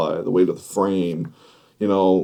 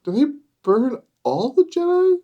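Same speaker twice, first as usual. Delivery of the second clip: put-on high curious child question; kid voice. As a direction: voice a small kid asking.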